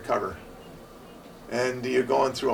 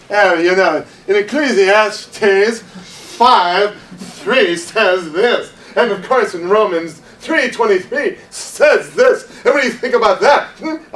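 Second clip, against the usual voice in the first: louder, higher, lecturing smugly; condescending know-it-all; 7 semitones higher, 9 dB louder, slower than usual. Pretend. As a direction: quote verses down at them. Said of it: "don't come across as".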